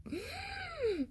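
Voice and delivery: high sharp breathy gasp; shocked gasp. Real, not acted. Vlogger realizing her spending at the mall, high gasp.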